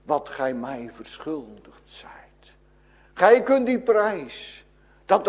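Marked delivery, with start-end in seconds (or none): 0.0-2.5 slow whispered judgment. Acted. God's verdict whispered slowly to the sinner.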